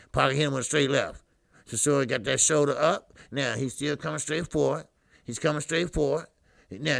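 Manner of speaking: steady ringside commentary; casual fight commentary; play-by-play. Acted. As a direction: keep calling the action blow by blow.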